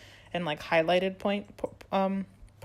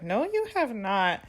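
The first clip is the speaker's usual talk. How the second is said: put-on defiant teen; 4 semitones higher, slower than usual. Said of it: "Veronica's like".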